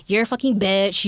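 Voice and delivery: usual speaking voice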